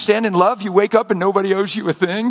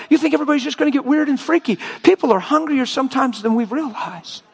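ordinary talk

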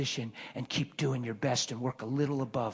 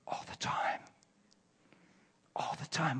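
soft unvoiced whisper, softer; 9 dB quieter than usual. Real, not acted. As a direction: confide it under your breath. Hushed emphasis on frequency.

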